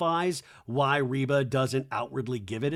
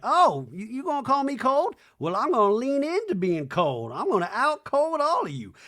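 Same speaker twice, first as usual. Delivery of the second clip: high, loud mock defiance; hammed-up high defiant voice; mock cold lady; 10 semitones higher, 5 dB louder than usual. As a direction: play the cold older lady. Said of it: "imitate this version".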